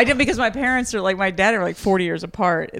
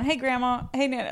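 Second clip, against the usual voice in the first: imitated high, soft greeting; endearing phone greeting; 5 semitones higher, 9 dB quieter than usual. Someone picking up grandmother's call, affectionately high and soft. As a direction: lightly mimic a sweet hello on the phone. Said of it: "answer the phone".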